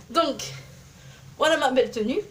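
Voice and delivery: normal talking voice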